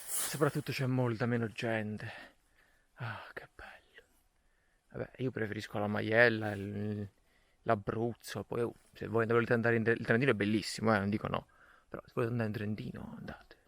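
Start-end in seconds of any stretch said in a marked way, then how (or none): 2.9-4.0 breathy whispered delight. Real, not acted. Savouring the empty trail. 11.9-13.6 quick whispered aside. Real, not acted. Aside about Trentino.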